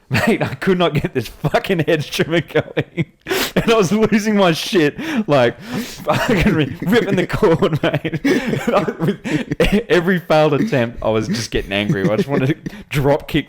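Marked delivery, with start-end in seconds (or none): 0.1-11.0 laughing. Real, not acted. Laughing while retelling hedge trimmer rage.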